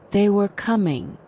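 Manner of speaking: plain reading voice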